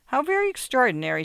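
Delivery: high, quick, loud exclamation; crying out. A narrator's reading. Wife answers her husband's contradiction, crying out. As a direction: exclaim it in mock astonishment. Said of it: "she cried".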